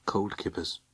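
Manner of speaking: softly muttered to herself; quiet murmur. Acted. Alison repeats her calming phrase.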